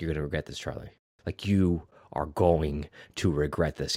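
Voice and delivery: low, very soft imitated menace; understated movie-villain threat; quiet threat. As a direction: act a hushed warning, falling away. Voicing Ray's threat to Charlie.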